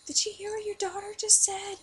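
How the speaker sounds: high, loud mock-outraged calling out; imitated shocked mom. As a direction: call out to a husband in disbelief. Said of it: "she was like".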